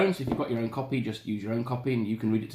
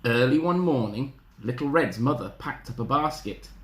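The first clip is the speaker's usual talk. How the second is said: usual speaking voice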